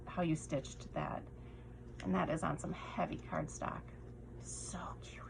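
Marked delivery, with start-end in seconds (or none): 4.4-5.2 tiny adoring whisper. Real, not acted. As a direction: breathe it out softly. Admiring wand, whispered.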